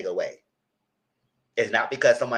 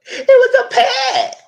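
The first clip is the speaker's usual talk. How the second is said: very high, slow laughing squeal; 10 dB louder, much slower than usual. Real, not acted. About the hug, a high slow laughing squeal. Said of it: "laughing through it".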